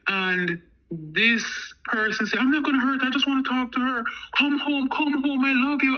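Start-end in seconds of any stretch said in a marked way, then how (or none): 2.4-6.0 high-pitched retold begging; imitated pleading. Woman begging the man to come home, imitated pleading. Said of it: "begging him and pleading with him".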